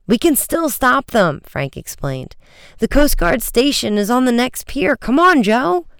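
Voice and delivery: rally a partner to act now; excited, pressing boyish plan; urgent. A narrator's reading.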